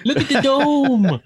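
put-on amazed kid.

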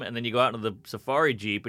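normal talking voice

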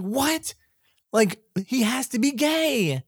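high, incredulous cry of what; shocked disbelief. Retold or imitated. Fan reacting to the book's big twist, high and incredulous. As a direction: squeal it in total disbelief.

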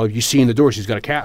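normal talking voice

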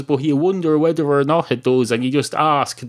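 normal talking voice

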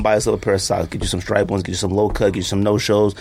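normal talking voice

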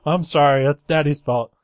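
a dad in a panic saying sorry; flustered dad apology. Retold or imitated. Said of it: "he's like".

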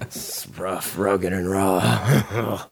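slow, low mock-sultry read. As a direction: ham up a steamy romance line. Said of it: "I'm gonna read you an excerpt".

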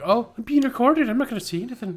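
high, loud mock assistant voice; coy assistant. Owner voices Siri knowing she's recorded, high mock coy. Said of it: "She's like".

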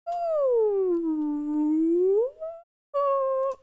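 high rising whale moan; whale call. Acted. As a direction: sing it out like a whale. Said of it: "one of them says".

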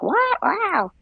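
high-pitched imitation of disbelief; mock shocked reaction. Teacher mimics people objecting to beginners teaching, high mock shock. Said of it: "some people are gonna be like".

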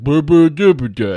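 mock drunk babble, slurred and wobbly; drunk mumbling. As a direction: lightly imitate a drunk man fumbling for words. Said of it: "he was all wibbly wobbly".

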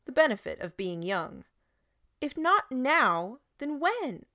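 put-on boy loudly challenging the shepherd before the crowd. A narrator's reading. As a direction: fling the question out loud. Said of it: "louder than was polite".

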